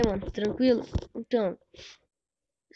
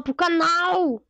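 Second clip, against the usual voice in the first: higher, slow stretched-out call; drawn-out exclamation; 6 semitones higher, much slower than usual. Real, not acted. Streamer repeating himself to the chat, drawn-out exclamation. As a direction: stretch the last word up high.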